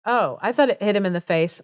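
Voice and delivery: ordinary talk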